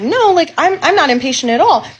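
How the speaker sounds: high, emphatic retold denial; correcting him. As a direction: push back insistently, pitch jumping up. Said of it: "I corrected him".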